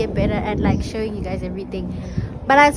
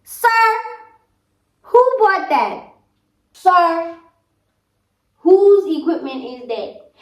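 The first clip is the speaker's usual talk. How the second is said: mock scolding; 6 semitones higher, 6 dB louder, slower than usual. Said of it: "your guys' father out here rushing me".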